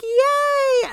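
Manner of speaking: very high, loud squeal of fake joy; mock cheer. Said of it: "Lacey's like".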